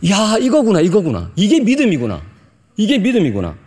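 re-enacted revelation, pitched high; awed realization. Preacher recalling the moment he understood faith, mildly excited realization. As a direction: exclaim it as a sudden discovery, high and falling. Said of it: "제가 그때 엄청난 은혜를 받았습니다".

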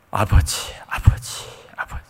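whispered, tear-choked calling; tearful prayer. Retold or imitated. Kneeling alone in the prayer hall, whispering through tears. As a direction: breathe the word out through tears. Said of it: "그냥 눈물이 쏟아져 나오는 거예요".

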